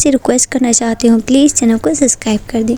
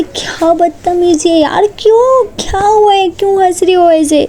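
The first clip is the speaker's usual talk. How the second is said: angry high demand; 7 semitones higher than usual. A narrator's reading. Neha angrily confronting her laughing friend. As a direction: demand an answer, cross and shrill-pitched. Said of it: "Neha को बहुत गुस्सा आने लग जाता है".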